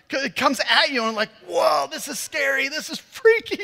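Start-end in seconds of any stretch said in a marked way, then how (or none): 1.5-3.6 mock scared. Firework shooting at them.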